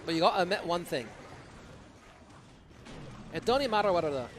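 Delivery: Scottish attempt slipping into Irish; accent gone fully Irish. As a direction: aim for Scottish and land in Irish. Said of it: "went fully Irish".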